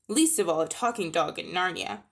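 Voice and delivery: usual speaking voice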